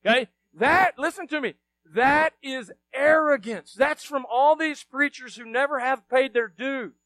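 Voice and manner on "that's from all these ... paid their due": ordinary talk, nothing marked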